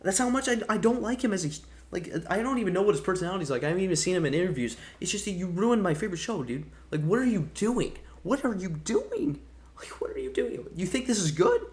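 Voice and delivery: pissed-off rant. Real, not acted. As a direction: vent real fury at the writer. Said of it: "I got really pissed off".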